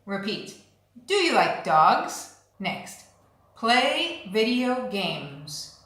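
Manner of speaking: ordinary talk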